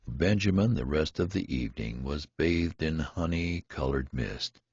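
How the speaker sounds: plain reading voice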